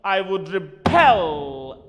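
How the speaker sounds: repel said slow and loud, stress on the second syllable; stress on rebel. Shown as a demonstration. Teacher showing the verb stress of rebel to students, slow and loud. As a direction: drag out repel, hitting the second part. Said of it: "stress on B".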